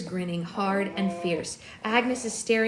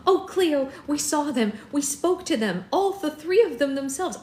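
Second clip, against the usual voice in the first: excited; 5 semitones higher, 5 dB louder than usual. Acted. Bella bursts out excited news.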